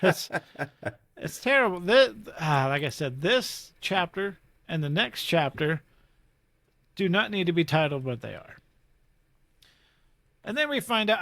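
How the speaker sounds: usual speaking voice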